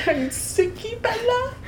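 put-on very high baby-talk; squeaky mock-mom fussing; babying mother. Mother fussing over Bella, babying.

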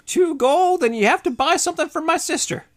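high, loud hag voice; insistent high-pitched hag; hard-bargaining hag. Acted. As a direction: lay down terms like a market hag.

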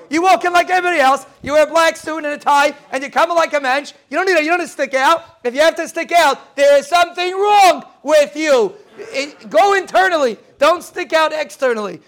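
high, loud emphatic lecturing; insistent preaching. Retold or imitated. Retelling the uncle's advice to the class, high insistent preaching. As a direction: drive the lesson home with force. Uncle's teaching, urging conformity.

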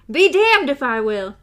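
loud defiant refusal. A narrator's reading. Gasher defying TikTok, loud and angry. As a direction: hurl the refusal back.

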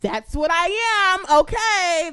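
loud and high, mock outrage; indignant outburst. Real, not acted. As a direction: shout it back at the catcaller. Indignant rant at catcallers.

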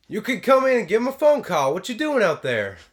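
high, loud, blustery uncle; Uncle Rico voice. Acted. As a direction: bark it down like Uncle Rico from the house. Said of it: "my Uncle Rico voice is pretty good".

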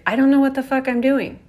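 loud, fast, frantic admission; vulnerable outburst. Retold or imitated. A frightened inner child blurting out to herself, loud and fast. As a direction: blurt it out, overwhelmed and lost. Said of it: "the vulnerability of".

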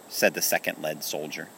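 ordinary delivery

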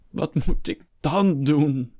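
high, drawn-out despairing wail; slow, high lament. A narrator's reading. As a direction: wail it helplessly, stretching the words. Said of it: "Jammerde Hector".

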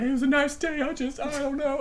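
high, meek retold excuse; meek honesty. Driver being honest with the officer, meekly flustered. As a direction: offer it helplessly. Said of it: "I was just being so honest".

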